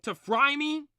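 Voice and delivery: high, loud outraged protest; indignant yelling. Acted. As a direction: yell it at whoever just hit you. Bill hit, protesting angrily.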